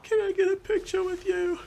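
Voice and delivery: very high, tearful mock child voice; crying kid. Young fan asking for a photo, imitated high tearful voice. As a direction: imitate a sobbing little fan pleading. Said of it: "And the kid is like".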